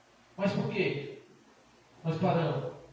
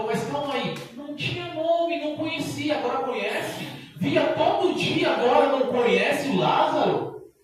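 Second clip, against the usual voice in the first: higher and louder, building to a shout; rising preaching shout; 7 semitones higher, 5 dB louder than usual. Real, not acted. Preacher to church, building to a loud shout. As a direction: preach it up till you're shouting.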